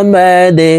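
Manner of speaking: মা দে with the দ bounced down sharply; qalqalah. Shown as a demonstration. Teacher demonstrating the echoing দ at the stop. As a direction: give the দ in মা দে a downward bounce. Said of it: "ধাক্কা দিয়ে কলকলা করে উচ্চারণ করতে হবে".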